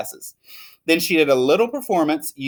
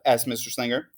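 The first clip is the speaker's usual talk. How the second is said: plain reading voice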